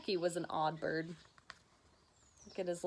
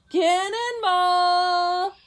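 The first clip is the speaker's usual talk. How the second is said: loud, high, slow yell; cannonball yell; 10 semitones higher, 12 dB louder, much slower than usual. Acted. Tacky yells loud and high as he jumps in. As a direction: stretch out the yell as you jump.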